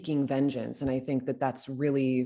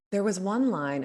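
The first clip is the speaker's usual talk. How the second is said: ordinary talk, nothing marked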